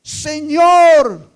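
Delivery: full-voiced, high cry of the Lord's name; desperate shout. Preacher acting the woman crying out to Jesus, full shout. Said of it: "a gritar".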